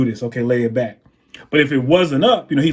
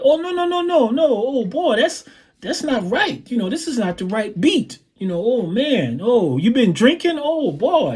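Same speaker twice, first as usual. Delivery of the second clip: very high, swooping mock complaint; 9 semitones higher than usual. Singer stopping the drummer mid-song, imitated high scolding. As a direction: imitate a fussy singer halting the band, high-pitched. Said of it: "He's like".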